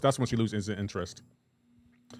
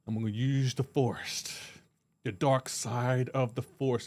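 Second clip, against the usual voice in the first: mock Vader voice; 5 dB quieter, slower than usual. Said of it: "And he's just like".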